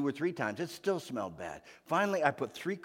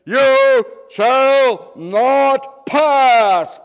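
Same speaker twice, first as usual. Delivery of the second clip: very slow, huge thundering roar, much higher and louder; Gandalf roaring; 21 dB louder, much slower than usual. Acted. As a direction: stretch every word into a booming command. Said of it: "and he says".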